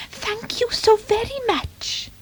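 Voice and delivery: silky high-pitched feline purr; purring cat. Acted. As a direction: purr your thanks like a grateful cat. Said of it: "she purred".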